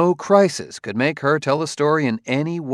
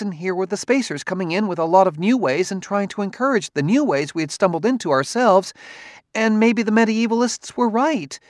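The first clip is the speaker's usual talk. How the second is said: higher, chattering wife; rambling, nervous wife; 9 semitones higher than usual. A narrator's reading. Wife voiced in story.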